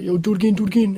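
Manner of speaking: high, loud egging-on; Harry. Retold or imitated. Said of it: "Harry was freaking out".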